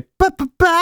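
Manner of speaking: high "pa pa pa" like a trumpet; imitated trumpet. Shown as a demonstration. Host demonstrating the sound of a trumpet, high tooting. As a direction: toot a high trumpet fanfare on "pa". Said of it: "di una tromba".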